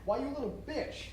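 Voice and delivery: angry curse. Acted. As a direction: spit it at her. James cursing Sally.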